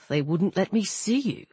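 low, soft, grave male figure; subdued, solemn god's voice. Acted. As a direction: speak low and quietly, holding back. Voices Anubis in dialogue.

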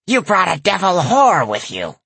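high sneering granny voice; sneering old lady. A narrator's reading. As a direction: sneer it with contempt. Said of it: "the old lady sneered".

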